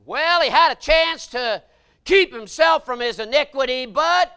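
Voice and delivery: loud, high and slow preacher's holler; full-force preaching. Acted. Preacher warning the church about sin, slow loud holler. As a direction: hammer each phrase at them.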